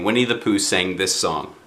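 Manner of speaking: ordinary delivery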